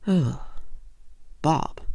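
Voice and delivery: usual speaking voice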